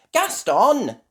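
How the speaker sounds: high, loud call; calling the pet. A narrator's reading. Ben calling to Gaston the ladybird, high call. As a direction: call out the name brightly high.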